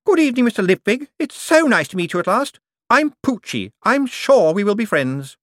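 high, sugary voice of a hopeful woman; sweet cloying lady. A narrator's reading. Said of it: "a voice in the gloom said".

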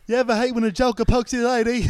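loud, high mock British accent. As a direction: ham up a Cockney British accent. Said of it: "Talk like this".

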